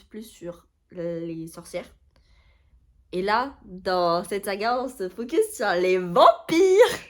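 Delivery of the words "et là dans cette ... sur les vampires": lente montée jusqu'à un « vampires » fort et aigu; annonce emphatique. Real, not acted. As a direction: scande chaque mot, de plus en plus fort. Annonce théâtrale des vampires de la saga. (annonce théâtrale, de plus en plus forte)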